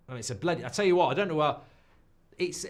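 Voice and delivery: ordinary talk, nothing marked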